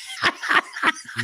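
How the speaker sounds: high, airy laugh at a joke; breathy silent laugh. Real, not acted.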